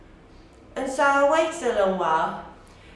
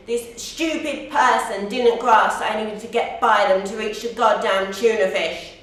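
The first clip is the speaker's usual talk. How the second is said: louder, exasperated outburst; exasperated rant; 5 dB louder than usual. Acted. Woman blocked from the tuna fish, loud exasperated complaint. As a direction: push it out in loud frustration.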